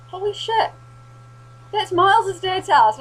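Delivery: high, loud amazed exclaim; astonished recognition. Retold or imitated. Spotting a friend's dad's house, she exclaims high in amazement.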